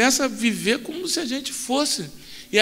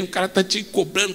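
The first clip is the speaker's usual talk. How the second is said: usual speaking voice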